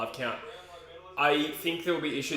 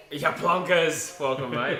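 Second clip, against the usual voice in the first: mock British greeting; 3 semitones higher, 7 dB louder, much slower than usual. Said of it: "Good day, Plonkers".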